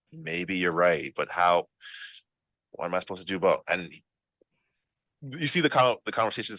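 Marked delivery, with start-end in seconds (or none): 0.0-3.6 a touch of torn hesitation, sighing between phrases; mildly conflicted. Acted. Villager wrestling with having sent his children away, mildly conflicted. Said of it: "the struggle within him".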